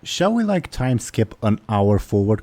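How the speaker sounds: ordinary delivery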